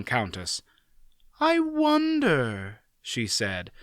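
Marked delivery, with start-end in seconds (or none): 1.4-2.8 musing. Acted. Aunt Pole musing.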